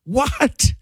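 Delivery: high-pitched, disbelieving "what?"; incredulous. Retold or imitated. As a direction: blurt it out in disbelief. Reacts to the cop's warning.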